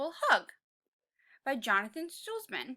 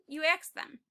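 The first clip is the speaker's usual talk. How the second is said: ordinary delivery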